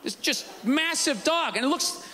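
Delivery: ordinary talk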